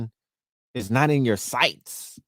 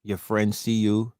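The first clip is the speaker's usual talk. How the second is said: normal talking voice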